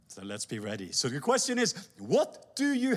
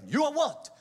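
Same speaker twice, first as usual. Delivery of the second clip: ordinary delivery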